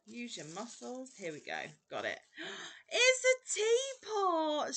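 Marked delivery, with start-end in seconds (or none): 2.2-2.9 sharp high gasp of delight; quick surprised intake of breath. Real, not acted. Finds teapot hook. 3.0-4.8 high, loud, slow squeal; thrilled high squeal over a gift; squealing delight. Real, not acted. Delighted at teapot hook.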